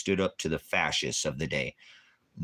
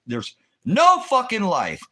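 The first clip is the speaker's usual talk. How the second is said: much higher and louder, slow and shouted; furious shout; 9 dB louder, slower than usual. Real, not acted. Ranting to the stream that the country is dead, shouting. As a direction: yell it out slowly, driving the point home. Ranting that America has no life.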